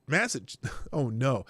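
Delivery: usual speaking voice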